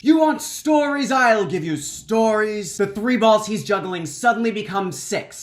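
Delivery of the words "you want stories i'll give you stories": loud, high showman's proclamation; grand speech. Retold or imitated. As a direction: announce it to a gathering crowd, stretching the promise. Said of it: "Tom goes into quite the speech".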